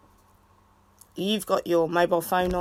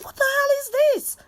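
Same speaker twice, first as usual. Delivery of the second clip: mock outrage; faster than usual. Mother mock-outraged at her daughter's complaint. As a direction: act shocked and indignant. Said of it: "I was like".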